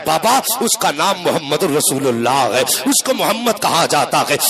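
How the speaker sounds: fervent sermon storytelling, driven on at full force; impassioned preaching. Acted. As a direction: preach the tale with relentless, rousing intensity. (پرجوش خطیبانہ انداز)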